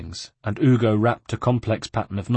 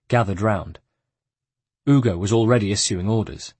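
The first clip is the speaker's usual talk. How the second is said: ordinary delivery, nothing marked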